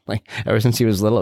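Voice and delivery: ordinary talk, nothing marked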